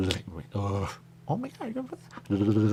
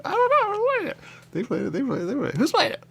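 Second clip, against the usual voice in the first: high excited voice; 8 dB louder than usual. Real, not acted.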